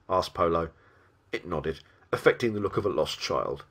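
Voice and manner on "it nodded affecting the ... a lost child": ordinary delivery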